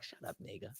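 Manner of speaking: very soft, low grumble under the breath; muttered retort. Retold or imitated. Player grumbling about the coach's son, muttered retort. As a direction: imitate players grumbling, barely audible.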